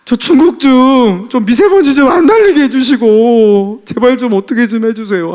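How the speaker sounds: re-enacted crying prayer, high and loud; weeping prayer. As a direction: cry out to God, half laughing. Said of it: "제가 진짜 막 울면서 기도하면서".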